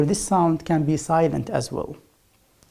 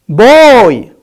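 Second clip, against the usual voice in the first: "Boy!" yelled very loud and higher, with a voiced b; shouted boy; 4 semitones higher, 13 dB louder than usual. Shown as a demonstration. Teacher shouting boy at the paper to show it won't move. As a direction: belt out "Boy!" at full volume. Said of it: "I'll shout, okay?".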